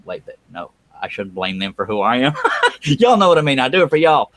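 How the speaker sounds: ordinary talk, nothing marked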